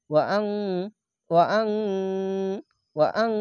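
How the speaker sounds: ওয়া আং with the ng hummed long; hidden nasal n. Shown as a demonstration. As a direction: hold the end of ওয়া আং in the nose. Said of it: "ইকফা গুন্না". (ওয়া আং-এ ইকফা গুন্না)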